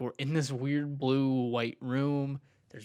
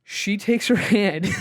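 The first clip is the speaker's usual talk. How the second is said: words bubbling with a real laugh, higher and louder; laughing through it; 6 semitones higher, 9 dB louder, slower than usual. Real, not acted. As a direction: laugh as you tell it. Laughing while telling the swab.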